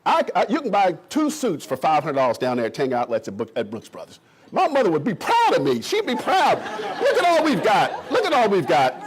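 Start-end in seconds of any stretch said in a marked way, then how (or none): 4.5-8.9 beam with pride; proud laugh. Real, not acted. Boasting to the crowd, laughing pride.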